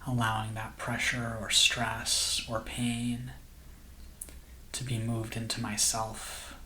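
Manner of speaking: soft, slow meditation voice with long pauses; guided meditation. Acted. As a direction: gently guide listeners into calm, unhurried and hushed.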